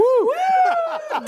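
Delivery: high, loud whoop; bench cheering. Retold or imitated. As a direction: let out a huge victory whoop. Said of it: "everybody's going".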